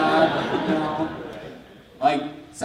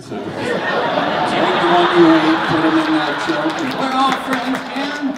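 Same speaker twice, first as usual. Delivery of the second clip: laughing appeal; 11 semitones higher, 6 dB louder, slower than usual. Real, not acted.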